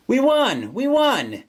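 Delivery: high, excited cheer; bouncing, high-pitched glee on each word. A narrator's reading. Sana celebrates beating the boss, excited. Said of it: "bounces up on her toes excitedly".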